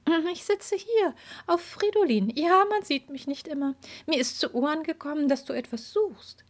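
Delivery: high, airy invisible fairy. Acted. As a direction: play a playful unseen fairy.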